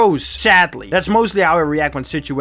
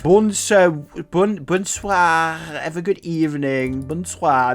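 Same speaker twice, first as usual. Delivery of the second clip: slow, drawn-out sassy French bonsoir; sassy French accent; slower than usual. Retold or imitated. Leaving the elevator, saying goodnight to the rude family in a sassy French accent. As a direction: bonsoir with a saucy French lilt. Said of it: "with the most sassy French accent I could give".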